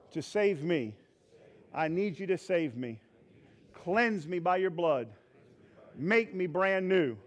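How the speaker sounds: phrase by phrase with long pauses for the church to repeat; measured call-and-repeat prayer; led prayer. Real, not acted. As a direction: lead the prayer line by line. Leads congregation in repeated prayer.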